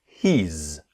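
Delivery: "he's" said as a single syllable, not "he is"; one-syllable "he's". Shown as a demonstration. Teacher demonstrating "he's" as one syllable. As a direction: squeeze "he is" into one beat. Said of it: "Listen and repeat".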